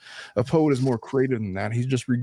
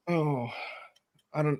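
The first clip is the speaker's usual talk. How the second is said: ordinary delivery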